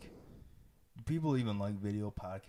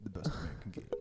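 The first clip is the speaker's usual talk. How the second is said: hushed commercial tagline; breathy ad whisper; whispered ad slogan; 6 dB quieter than usual. Pretend.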